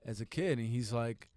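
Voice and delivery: ordinary delivery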